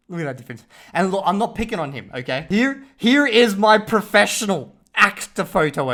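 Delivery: usual speaking voice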